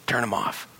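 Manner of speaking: ordinary talk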